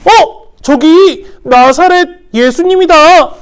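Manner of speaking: high, loud excited discovery; surprised crowd voice. Acted. People in the crowd spotting Jesus, surprised high call. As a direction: point him out in surprise.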